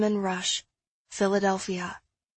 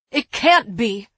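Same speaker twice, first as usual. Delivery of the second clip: loud, sharp contradiction; snapped; 11 dB louder than usual. A narrator's reading. Frantic woman disputing the open window, snapping loudly. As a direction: snap it back, raised voice. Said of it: "Eliza snapped".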